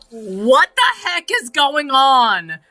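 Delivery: high, loud laughing whisper; laughing disbelief. Real, not acted.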